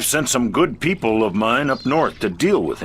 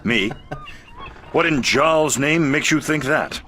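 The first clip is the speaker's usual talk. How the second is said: sarcastic; 6 semitones higher than usual. Acted. Denying sarcasm with a laugh.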